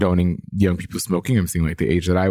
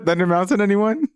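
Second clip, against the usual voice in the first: laughing; 12 semitones higher than usual. Real, not acted.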